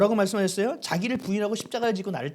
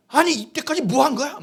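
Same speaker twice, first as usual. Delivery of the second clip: raised, loud accusing question; angry outburst; 7 semitones higher, 7 dB louder than usual. Retold or imitated. Pastor retells blaming his wife over the sick child, angrily. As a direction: lash out as in a retold marital row. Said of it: "막 이러면서 화를 막 내는 거예요".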